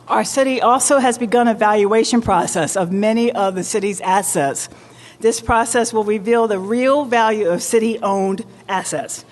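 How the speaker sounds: normal talking voice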